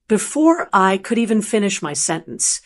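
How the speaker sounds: plain reading voice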